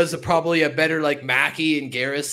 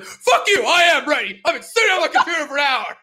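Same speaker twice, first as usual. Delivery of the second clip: high, loud mock outburst; Scoop ready to fight; 10 semitones higher, 5 dB louder than usual. Host mimicking his co-host firing back at a text, mock angry. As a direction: imitate a friend blowing up, pitched way up. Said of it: "Scoop is always ready to fight".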